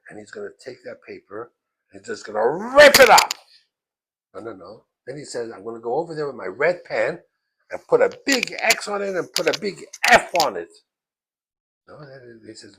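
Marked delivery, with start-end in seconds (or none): 2.0-3.3 loud, high burst of fury; furious threat. Retold or imitated. Rebbe about to rip up the test, furious. Teacher imagines ripping the paper. 5.5-10.7 loud, vindictive threat; angry vow. Retold or imitated. Rebbe vowing to fail the cheater, angry. Teacher vows to fail the kid.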